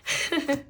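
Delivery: brief high self-deprecating laugh; short laugh. Real, not acted. Admitting to viewers she's not on that level, short laugh. Laughs at own card skills.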